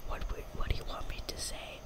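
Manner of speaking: ordinary talk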